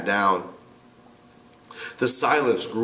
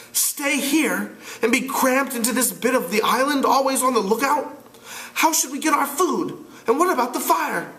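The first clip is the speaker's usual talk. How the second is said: put-on higher boyish voice, exasperated; a young leader losing patience; impatient Ralph; 13 semitones higher than usual. Ralph arguing back at Piggy, exasperated.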